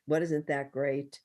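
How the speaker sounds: ordinary talk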